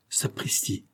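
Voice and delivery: a breathless, stunned gasp of a word; astonished hushed oath; stunned exclamation. A narrator's reading. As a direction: caught off guard, almost voiceless. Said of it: "s'exclama Fanferlot stupéfait".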